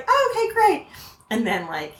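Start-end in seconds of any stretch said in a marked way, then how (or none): 0.1-0.9 high, singsong acknowledgement; retold high-pitched encouragement; humouring parent. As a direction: pitch it way up to humour a chatty child. Parent humouring a chattering child.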